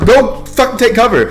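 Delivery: high, slow, emphatic command; exasperated shouted order. Retold or imitated. Guard ordering colonel to cover, exasperated shouting. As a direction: yell it word by word, near laughing.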